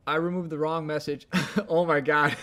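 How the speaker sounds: loud, laughing dismay; real embarrassed laughter. Real, not acted. Streamer owning up to deleting the wrong message, laughing in embarrassment. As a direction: laugh loudly at your own blunder.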